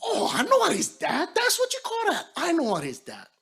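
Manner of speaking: very high, delighted recognition; light up as it suddenly clicks; excited realization. Retold or imitated. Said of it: "you go".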